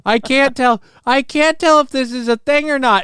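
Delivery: high-pitched disbelief; can't believe what you see; incredulous. Real, not acted. Reacting to a clip.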